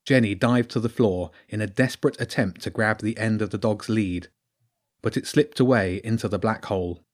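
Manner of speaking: plain reading voice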